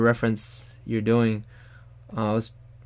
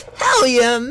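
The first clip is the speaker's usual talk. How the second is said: much higher and louder "Hell yeah"; all-out shout; 12 semitones higher, 7 dB louder, slower than usual. Retold or imitated. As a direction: hold nothing back, scream it. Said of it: "I'm not holding anything back".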